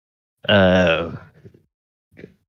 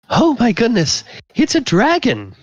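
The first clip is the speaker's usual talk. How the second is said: much higher, giddy tipsy gasp; tipsy woman; 14 semitones higher than usual. Acted. Tipsy party guest startled by a dragon, much higher squeal. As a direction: squeal it like a woman who's had a few too many. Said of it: "she says it in a way that clearly she's a little bit tipsy".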